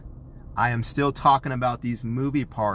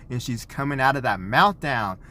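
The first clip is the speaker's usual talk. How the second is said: ordinary talk, nothing marked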